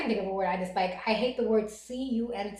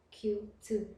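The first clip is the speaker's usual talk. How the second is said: normal talking voice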